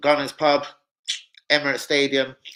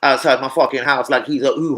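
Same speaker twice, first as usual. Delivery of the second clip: ordinary delivery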